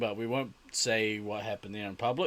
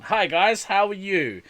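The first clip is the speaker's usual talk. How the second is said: normal talking voice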